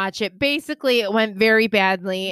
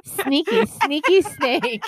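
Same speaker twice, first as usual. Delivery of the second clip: bad TikTok reenactment; 5 semitones higher, much slower than usual. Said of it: "I'm not even doing a good job reenacting it".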